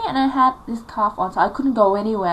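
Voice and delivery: usual speaking voice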